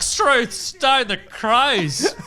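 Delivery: exaggerated Australian ocker, high and loud, dragged out slowly; broad Australian accent. Character upping his Aussie charm, hammed-up broad Australian drawl. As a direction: lay the Australian accent on thick and slow. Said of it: "keep upping the ante".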